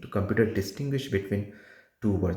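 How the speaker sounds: ordinary delivery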